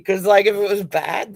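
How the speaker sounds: ordinary talk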